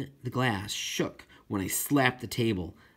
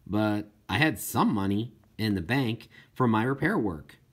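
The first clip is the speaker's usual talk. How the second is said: usual speaking voice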